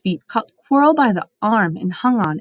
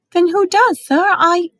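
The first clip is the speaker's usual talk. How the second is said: anxious; 11 semitones higher, 5 dB louder, slower than usual. A narrator's reading. Harry anxious, interrupted.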